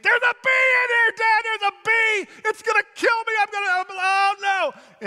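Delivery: put-on high, loud terrified shriek of a little girl; panicked screaming child. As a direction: scream it like a kid panicking over a bee. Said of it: "she started screaming in the back seat".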